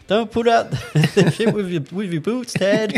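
high laughing quote of the movie line; quoting Happy Gilmore to a mate, laughing. Retold or imitated. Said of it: "what's the Happy Gilmore?".

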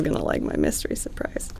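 whisper. Pretend. Mock sulking whisper.

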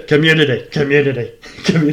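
community repeated in a low Belfast accent; Belfast get-in word. Shown as a demonstration. A guest warms up a Belfast accent with community. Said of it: "my get-in phrase is community".

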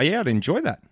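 high, measured polite voice; polite noncommittal reply. Shown as a demonstration. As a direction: say it like a stiff polite guest. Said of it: "I'll show you what you could do instead".

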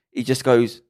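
ordinary delivery

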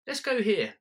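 high, eager partner voice; chirpy partner. Retold or imitated. Partner urging you into the bar, high and eager. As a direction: pitch it up bright and keen.

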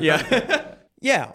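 laughing. Real, not acted. Still laughing at the tease.

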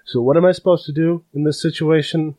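a nervous rookie guard asking for help; flustered new guard. Acted. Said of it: "he goes".

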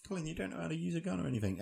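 fast, high teasing mimic; high mocking voice. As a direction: rattle it off in a high mock voice. Mocking the friend's voice.

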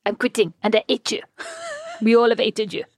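lightly put-on French accent, giggling midway; mock French accent. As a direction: playfully imitate a French colleague's accent. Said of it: "obviously not in that accent".

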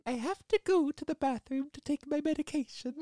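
put-on high lilting Welsh accent. Doubtfire excusing herself at dinner, Welsh-tinged accent. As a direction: lilt it in a Welsh granny voice. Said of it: "there's that Welsh accent coming in".

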